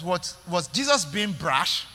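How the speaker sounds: ordinary talk, nothing marked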